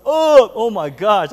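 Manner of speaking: high, loud, drawn-out mock agony; pained exclamation. Preacher recalling his mom's spanking, exaggerated pain. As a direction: cry it out slowly, reliving the beating.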